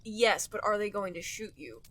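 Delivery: ordinary delivery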